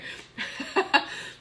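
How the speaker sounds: high, breaking into laughter; emotional laugh. Real, not acted. Streamer tearing up on live video, emotional laugh. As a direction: let a real laugh spill out. Overcome, laughing through tears.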